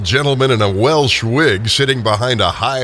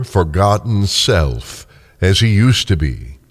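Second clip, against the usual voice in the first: ordinary talk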